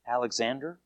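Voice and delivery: timid, low answer, barely voiced; hushed, hesitant mumble of a reply; quiet, under his voice. A narrator's reading. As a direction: say it small and soft, almost to yourself. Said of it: "quietly, like under his voice".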